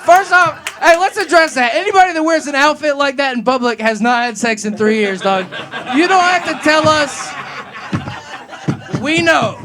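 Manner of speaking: high, shouted roast; loud heckler comeback. Real, not acted. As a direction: fire back at the heckler over the laughter.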